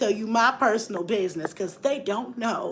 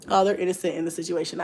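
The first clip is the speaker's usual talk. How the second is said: ordinary talk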